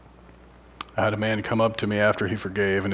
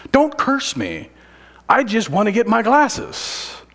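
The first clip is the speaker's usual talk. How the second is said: a sharp, raised protest; high, loud angry retort; 12 semitones higher, 6 dB louder than usual. Retold or imitated. Preacher voicing the prisoner snapping back at the guard, loud and high. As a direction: shout it up high, fed up.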